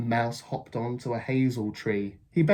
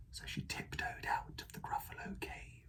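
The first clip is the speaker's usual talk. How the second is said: hushed tiptoe whisper; whispered narration; 15 dB quieter than usual. A narrator's reading. Narrator sneaking along with the child, whispered.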